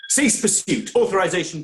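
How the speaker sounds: loud, high, urgent orders to the computer; commanding shout. Acted. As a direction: bark the override at the machine. Overriding the drone's tow order.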